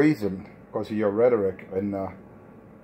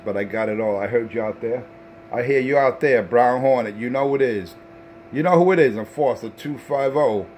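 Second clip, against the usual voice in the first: radio call-out; 2 semitones higher than usual. Acted. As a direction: hype yourself up calling over the airwaves. Shouting call-outs over the radio.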